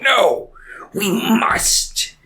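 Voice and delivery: high, dramatic put-on refusal; pause, then declare it with hammed-up urgency; mock insistence. Father demanding Boromir bring the ring, high mock insistence.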